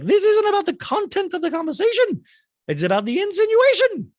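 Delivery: high, loud mocking impression of Drew. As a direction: lampoon her protest high and loud. Mocking Drew's voice in a quarrel.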